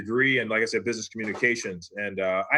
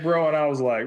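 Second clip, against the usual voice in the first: normal talking voice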